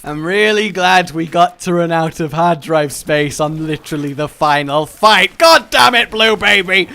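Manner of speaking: loud rant building to a high shout; exasperated rant. Real, not acted. Player cursing Blue Baby over full hard drive, exasperated. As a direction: build from loud sarcasm to a furious yell. Recording cut out during final fight.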